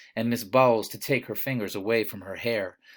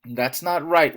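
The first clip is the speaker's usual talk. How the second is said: a mild wail of protest; a touch of a distressed wail; 4 semitones higher, 6 dB louder, slower than usual. A narrator's reading. Miss Bowles wailing against the reading, mildly distressed. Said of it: "wailed Miss Bowles".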